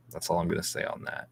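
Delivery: whispered under the breath, a little quick; a quick, soft whisper to close the topic; hushed aside. Real, not acted. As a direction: drop to a fast whisper as a private aside. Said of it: "repeats line hushed then plain".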